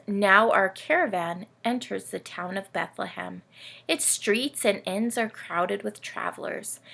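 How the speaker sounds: put-on lively read-aloud for kids, camel telling its tale; storybook reading. A narrator's reading. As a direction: tell it like a bedtime picture book. Camel narrator reads story.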